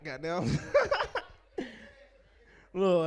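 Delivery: normal talking voice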